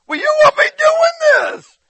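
very high, loud squeal of complaint; mock whining wail. Preacher acts out hearing God's word, high mock wail. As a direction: whine it at the top of your voice, exaggerated. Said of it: "I start whining and crying".

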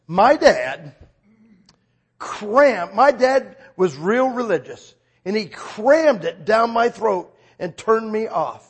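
loud, slow, heavily punched words; retold bitter complaint. Woman telling preacher about her religious dad, emphatic retold complaint. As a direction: imitate an emphatic, aggrieved complaint.

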